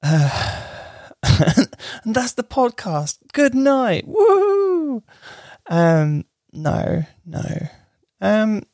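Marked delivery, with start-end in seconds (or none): 2.0-5.0 mock cheery sign-off. Joking fake sign-off.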